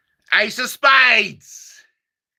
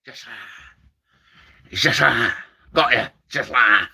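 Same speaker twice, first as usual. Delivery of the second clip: Tommy Cooper; 7 semitones lower than usual. Retold or imitated. Comic doing Tommy Cooper's catchphrase, low. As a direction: growl it like Cooper. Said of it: "my Tommy Cooper impression".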